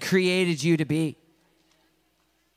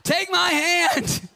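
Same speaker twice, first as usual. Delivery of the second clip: slow, high shout over the rotor; rescuer shouting; 12 semitones higher, 4 dB louder, much slower than usual. Retold or imitated. Helicopter rescuer calls to man in flood, slow high shout. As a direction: call down over the noise.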